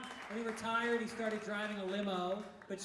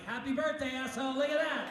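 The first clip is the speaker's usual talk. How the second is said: excited; 5 semitones higher, 7 dB louder than usual. Retold or imitated. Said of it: "I'm like".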